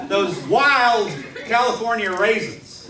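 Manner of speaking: ordinary delivery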